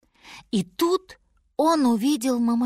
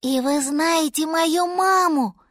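high, hopeful little mammoth voice; disbelieving joy; 7 semitones higher, 3 dB louder than usual. A narrator's reading. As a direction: ask as if too happy to believe it. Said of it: "не веря своему счастью".

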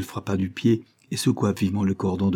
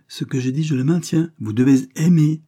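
insistent, building higher and louder; emphatic exclamation; 7 semitones higher, 5 dB louder than usual. A narrator's reading. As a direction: stand your ground, rising to a loud finish. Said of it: "s'écria-t-il". (exclamation insistante, de plus en plus forte)

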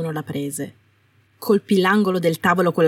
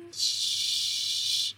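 slurred hiss; 9 dB quieter than usual. Acted. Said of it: "non sembravano riuscire ad uscirle attraverso i denti digrignanti".

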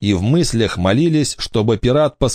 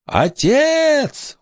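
high, drawn-out cry of "father"; exclaiming. A narrator's reading. Ghost girl claims pirate as father, exclaiming high. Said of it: "воскликнула Корделия".

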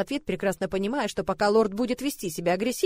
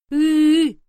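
put-on loud wordless toddler wail, higher; childish wail; 7 semitones higher, 11 dB louder than usual. Little girl wailing at her father. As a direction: bawl like a small child.

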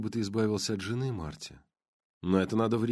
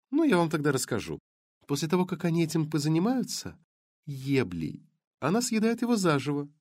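lightly voiced blonde; 6 semitones higher than usual.

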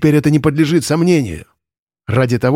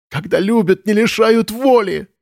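through sobs; 9 semitones higher than usual. A narrator's reading. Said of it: "сквозь непрекращающиеся рыдания".

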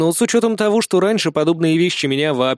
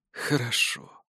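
hushed, frail whisper; old man whisper; 4 semitones lower, 10 dB quieter, slower than usual. A narrator's reading. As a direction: breathe it like a dying old man. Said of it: "прошептал старик".

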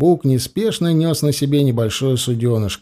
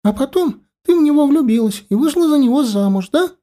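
put-on much higher little girl voice, rapturous; breathless delight; 10 semitones higher than usual. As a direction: gush like an enchanted small girl. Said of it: "С придыханием и восторгом".

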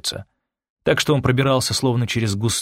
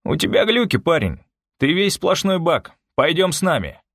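higher-pitched, patronizing taunt; condescending; 7 semitones higher than usual. A narrator's reading. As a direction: talk down to him with amused disdain. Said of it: "улыбнулся снисходительно".

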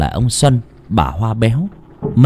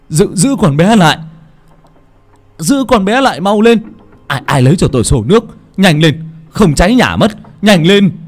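higher and louder, barking orders; urgent shout; 8 semitones higher, 4 dB louder than usual. Acted. Xuân shouts at the neighbours to hold the girl and fetch water, loud and urgent. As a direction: yell for help before the house burns. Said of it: "Ông Xuân đến đây thì quát lên".

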